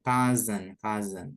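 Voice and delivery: COUSIN as "KUZ-un", K at the front; correct pronunciation. Shown as a demonstration. Teacher repeats for learners after explaining the letters, "KUZ-un" modelled clearly. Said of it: "C-nya jadi K".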